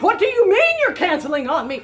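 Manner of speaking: loud, laughing mock anger; half-laughing angry retort; mock outrage. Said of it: "get mad".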